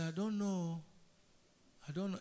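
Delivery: soft, hesitant fearful talk. Acted. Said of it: "to know a person who is fearful".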